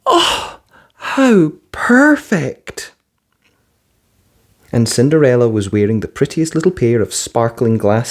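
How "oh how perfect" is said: very high, very slow wonder; delighted gasp. A narrator's reading. Cinderella delighted by her new ball gown, marvelling.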